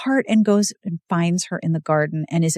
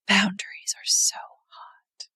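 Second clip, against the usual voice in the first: breathy swooning whisper; 4 dB quieter, slower than usual. Real, not acted. Swooning aside about boundaries.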